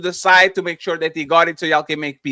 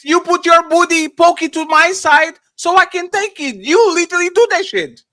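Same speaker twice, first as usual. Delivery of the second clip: mocking shout; 10 semitones higher, 5 dB louder than usual. Mocking women in a fight.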